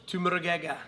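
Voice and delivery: put-on a try at an Irish accent that slips into Scottish; attempted Irish accent. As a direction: say McGregor in a shaky Irish brogue, drifting Scottish. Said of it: "That was a little more Scottish".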